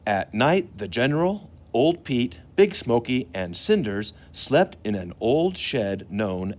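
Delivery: put-on storytelling for children. A narrator's reading. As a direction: tell the train story to the young listeners.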